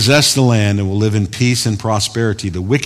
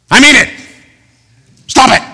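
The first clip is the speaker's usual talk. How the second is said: much higher and louder, a hammed-up yell; mock angry shout; 13 semitones higher, 7 dB louder, faster than usual. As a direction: bark it like a fake furious preacher. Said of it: "I was being angry there".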